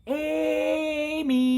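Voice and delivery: brother shouting up the stairs, high and loud; loud yell. A narrator's reading. Brother yelling up the stairs to wake Amy, a loud high shout. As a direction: bellow her name to wake her. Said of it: "yelled as loudly as he could".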